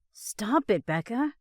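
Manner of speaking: a mildly cross, grunted brush-off. Acted. Driving boyfriend brushing off girlfriend, mildly cross grunt. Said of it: "Ross grunts crossly".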